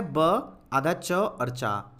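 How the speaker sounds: ब आधा च और चा, each piece slow and apart; slow spelling. Shown as a demonstration. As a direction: break the word into letters slowly. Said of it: "क्या है?". (धीरे-धीरे वर्ण अलग करके)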